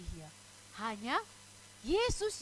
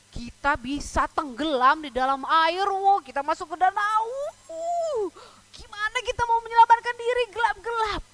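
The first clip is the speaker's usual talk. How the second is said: hammed-up alarm, climbing higher and louder; acted panic; 6 semitones higher, 7 dB louder than usual. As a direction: build into a high wail of mock panic. Acts out panic of drowning. (panik dibuat-buat, makin tinggi dan lantang)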